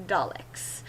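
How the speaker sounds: breathy whispered aside; hushed punchline whisper. Acted. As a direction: drop to a whisper on Daleks.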